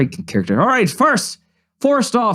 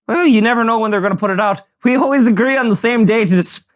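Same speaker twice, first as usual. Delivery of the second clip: hammed-up Irish brogue; horrible Irish accent; faster than usual. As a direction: greet the audience in a badly faked Irish accent.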